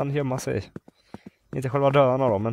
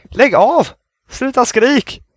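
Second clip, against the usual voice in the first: loud, high panicked shouting; frightened yelling; 9 semitones higher, 5 dB louder, slower than usual. Real, not acted. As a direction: yell at it to stop, desperate to escape. Yelling at screaming monster.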